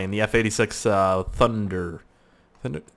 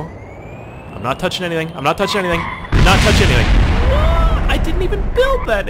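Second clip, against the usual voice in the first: rising from uneasy protest to high yell; escalating, incredulous outcry; mounting disbelief; 9 semitones higher, 5 dB louder than usual. Real, not acted. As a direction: protest higher and louder until it breaks into a shout. Plane moves by itself, protests mounting.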